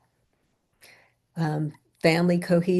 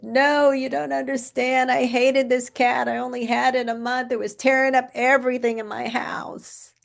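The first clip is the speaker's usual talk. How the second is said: imitating a crying client, pitched higher; tearful outburst; 7 semitones higher, 4 dB louder than usual. Retelling a grieving client's protest to her therapist, high tearful voice. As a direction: blurt it out through half-acted tears, higher and rushed. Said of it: "And through her tears".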